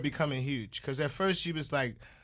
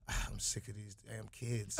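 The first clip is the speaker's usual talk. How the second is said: low, very quiet mutter; mumbled grumble; 6 semitones lower, 13 dB quieter than usual. Retold or imitated. Star muttering to her mom about kids, mumbled. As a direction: grumble it under your breath. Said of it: "mumbles to her mom".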